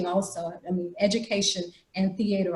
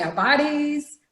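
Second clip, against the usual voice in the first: "Our bodies" called out higher for the class to repeat; call and response; 6 semitones higher, slower than usual. Shown as a demonstration. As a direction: lead the group: "Our bodies". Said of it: "everybody say".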